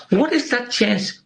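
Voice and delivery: amazed. Real, not acted. Marvelling at a lucky coincidence.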